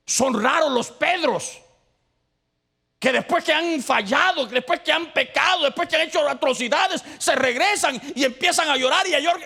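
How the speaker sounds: high, loud preacher's cry; impassioned pulpit shout; full-force preaching. Acted. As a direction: preach it hard to the congregation. Preacher voices repentant Peter.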